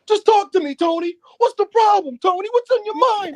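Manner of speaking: high, loud impression of a worried woman; mock pleading girlfriend. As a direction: imitate her urging Tony to open up, high-pitched. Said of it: "the girl was like".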